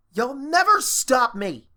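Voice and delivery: slow, loud, high angry vow. Acted. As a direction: spit it out in fury. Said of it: "Starlight said in anger".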